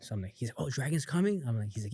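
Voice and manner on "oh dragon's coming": retold pleased surprise, pitched up; excited. Dad hears a friend is coming, excited. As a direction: imitate dad's rising, eager question. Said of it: "He's all excited".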